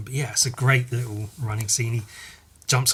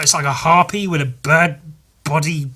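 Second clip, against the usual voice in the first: ordinary talk, nothing marked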